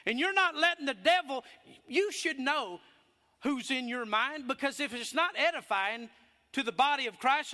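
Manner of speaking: loud, high, fervent preaching voice; full-force preaching. Acted. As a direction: preach it to the whole church, full voice. Preaching to congregation.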